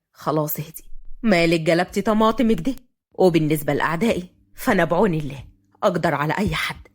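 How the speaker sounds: low male character voice; Fahd, teasing and confident. Acted. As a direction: tease her in a deep, assured voice. (بصوت فهد الواطي وهو بيهزر)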